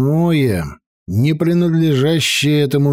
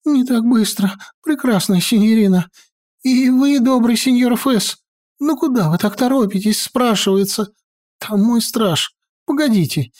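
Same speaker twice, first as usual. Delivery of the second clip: much higher, hurried nervous wizard; high fussy wizard voice; 9 semitones higher than usual. Acted. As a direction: fret and hurry them along. Maestro urges caution.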